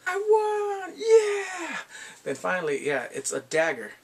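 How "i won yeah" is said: very high, loud, drawn-out yell of triumph; ecstatic, slow whooping cheer; victory shout. Retold or imitated. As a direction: scream it like you just won big. I was like.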